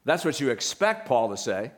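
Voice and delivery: ordinary talk, nothing marked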